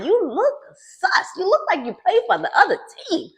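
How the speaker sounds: high, loud teasing call. Real, not acted. Vlogger teasing Jody in a high, loud, playful tone. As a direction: coax him out with playful mock encouragement.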